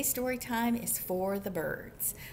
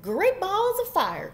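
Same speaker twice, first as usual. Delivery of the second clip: high, loud exclamation; big storybook goose outburst; goose's cry; 9 semitones higher, 9 dB louder, slower than usual. A narrator's reading. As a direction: holler it in outrage. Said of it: "cried the goose".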